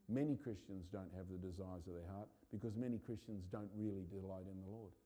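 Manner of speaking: ordinary talk, nothing marked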